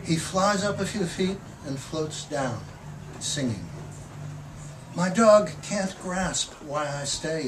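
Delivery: measured, expressive poetry reading; poem reading. Acted. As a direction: read the poem like you're savouring each image. Poet reads the poem Mockingbird.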